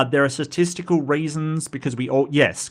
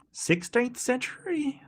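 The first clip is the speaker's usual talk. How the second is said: whisper; 10 semitones higher, 8 dB quieter than usual. Real, not acted. Unsure mid-search lookup.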